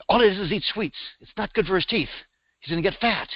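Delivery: high-pitched fretting mom voice; complaining mother. Retold or imitated. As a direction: imitate a mother griping about her son.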